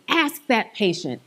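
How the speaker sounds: normal talking voice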